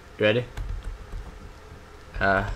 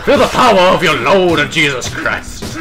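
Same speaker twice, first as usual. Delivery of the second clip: loud, high hammed-up preacher; preacher shout; 6 semitones higher, 8 dB louder than usual. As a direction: shout it like a televangelist.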